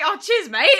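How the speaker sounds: loud, high sarcastic comeback; mock-indignant thanks. Streamer answering his unimpressed kids, mock-indignant. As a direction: thank them loudly, as if wounded.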